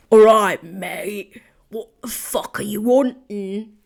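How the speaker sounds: loud, rough London pub landlord, a little hammed; a badly done London geezer accent barked out; self-mocking London accent of a pub owner from EastEnders. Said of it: "rough London geezer that owns".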